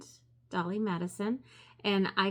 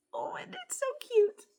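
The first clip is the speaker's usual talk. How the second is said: delighted squeal. Real, not acted. Delighted at a cute bag.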